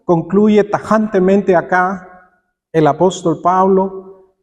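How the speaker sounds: usual speaking voice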